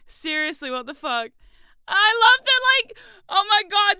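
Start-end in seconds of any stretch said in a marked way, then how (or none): 1.9-4.0 high, loud delight; thrilled by the comment. Real, not acted. Streamer thrilled by a chat comment.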